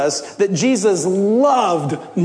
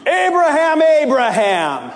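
calling out; 8 semitones higher than usual. Acted. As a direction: call from heaven across a distance. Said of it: "called out to him from heaven".

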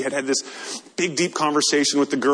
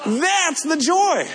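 exultant; 11 semitones higher, slower than usual. Real, not acted. Preacher exults after camp story.

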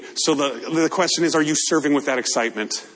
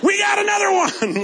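high, laughing shout; joyful shout. Pretend. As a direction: cheer it like a win. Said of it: "That's what we should say every baptism".

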